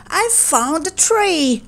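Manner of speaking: high, drawn-out excited cry. A narrator's reading. Boy brings his mother good news, crying out excitedly. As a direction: announce the find high, stretching it out. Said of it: "he cried".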